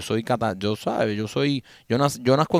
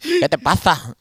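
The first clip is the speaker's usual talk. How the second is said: ¿qué te pasa? con acento de España, ce marcada; acento español imitado; 6 semitones higher, much slower than usual. Retold or imitated. (imitando a un español de burla)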